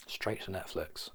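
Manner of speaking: breathy whispered aside; a sly whispered aside to the viewer. Acted. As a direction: whisper it like a secret. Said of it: "whispered aside".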